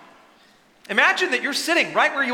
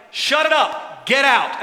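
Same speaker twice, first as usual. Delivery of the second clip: louder, forceful order; commanding; 5 semitones higher, 6 dB louder, slower than usual. Acted. Jesus casting out the demon, louder order. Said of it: "he's like".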